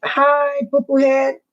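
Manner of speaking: high, loud sing-out to a little one; doting, silly phone hello; playful greeting. Real, not acted. Greets a child on a call.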